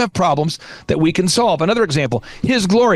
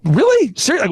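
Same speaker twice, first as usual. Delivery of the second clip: imitated disbelief, pitched high; mock-incredulous questions; incredulous reaction; 6 semitones higher than usual. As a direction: echo people's disbelief in a higher voice. Retold incredulous reaction of people.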